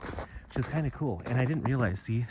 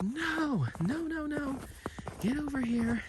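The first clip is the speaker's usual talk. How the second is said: high-pitched frantic calls to the dog; calling the dog off; slower than usual. Retold or imitated. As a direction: yelp no no no at a dog rolling in something dead. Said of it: "and I was like".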